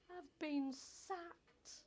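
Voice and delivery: high, very slow sobbing words; telling her friend she's sacked, sobbing; sobbed. Acted. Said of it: "I sobbed".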